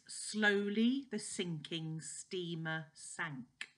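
slow, deliberate "Slowly the sinking steamer sank"; really slowly. Shown as a demonstration. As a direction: draw out every word. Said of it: "I'll say that really slowly for you".

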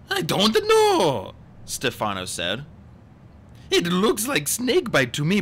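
high, loud put-on concern; hammed-up innocent act; scheming villain feigning distress. A narrator's reading. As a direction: plead ignorance in a high fake voice.

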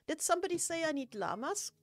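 ordinary talk

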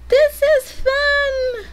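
very high, slow processed squeal; tiny voice-changer voice, stretched slowly; high-pitched voice effect. Shown as a demonstration. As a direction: draw it out through a high voice filter.

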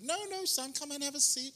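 high-pitched mimicry of an insistent hostess; high-voiced neighbor lady. Neighbor lady urging the boy to stay, high-pitched imitation. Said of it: "She's like".

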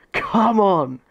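real celebratory shout; victory roar. Real, not acted. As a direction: roar it out in triumph. Reaching semi-finals.

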